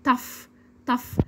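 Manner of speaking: "tough" ending in a clear f; spaced model repeats of "tough"; final GH as F. Shown as a demonstration. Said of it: "نسمع الكلمات ونردد".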